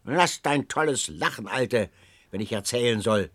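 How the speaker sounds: ordinary delivery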